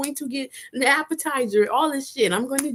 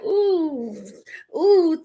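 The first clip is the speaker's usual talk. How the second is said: excited ooh; 6 semitones higher than usual. Retold or imitated. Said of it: "I was like".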